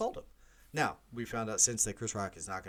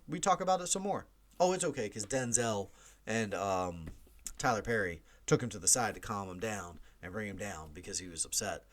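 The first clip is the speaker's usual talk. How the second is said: normal talking voice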